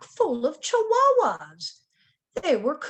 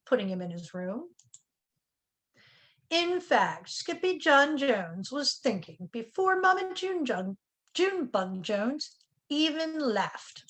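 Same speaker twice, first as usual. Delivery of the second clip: ordinary delivery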